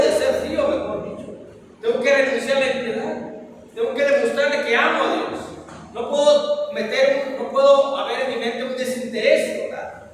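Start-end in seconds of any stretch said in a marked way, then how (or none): none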